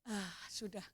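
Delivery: normal talking voice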